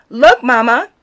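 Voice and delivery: put-on boy calls out loud and high; excited calling. A narrator's reading. Boy showing his painting to Mama, excited call.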